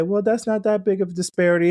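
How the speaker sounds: squeaky mock skeptic brushing it off. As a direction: parody a shrugging doubter. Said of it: "And you might say".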